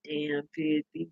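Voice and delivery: muttered under the breath; mumbled. Retold or imitated. Daddy muttering at the scene, soft mumble. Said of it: "He was mumbling something".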